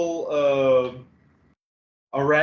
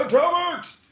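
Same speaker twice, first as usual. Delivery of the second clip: high, falling mock battle cry; Patrick Stewart cry. Co-host echoes the text tone joke, high mock cry. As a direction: echo the hammed-up actor's yelp. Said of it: "it's just Patrick Stewart going".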